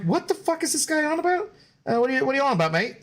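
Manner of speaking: mock British accent, high-pitched and baffled; a terrible British accent, confused; bad British accent, playfully mocking a confusing viewer. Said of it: "that terrible British accent".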